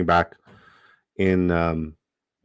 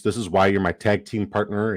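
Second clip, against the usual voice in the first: ordinary talk, nothing marked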